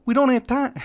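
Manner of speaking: urgent lisp, pitched well up; lisping Reza voice. Acted. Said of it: "get the lisp out".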